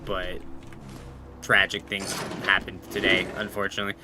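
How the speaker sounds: low, soft, halting condolence; breaking bad news. Telling the roommate about the squirrel, somber and apologetic. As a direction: retell it gently, pausing before the bad news. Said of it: "break the bad news to him".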